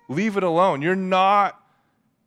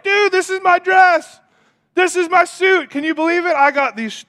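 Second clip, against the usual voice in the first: very high, loud boasting; hammed-up thrilled squeal of pride; mock proud excitement; 13 semitones higher, 6 dB louder than usual. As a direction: show it off with over-the-top glee. Proudly showing off homemade outfit.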